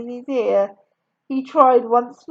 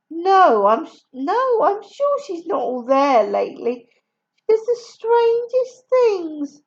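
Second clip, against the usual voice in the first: lightly voiced complaining sister; 7 semitones higher, 3 dB louder than usual. Helen complaining about sister.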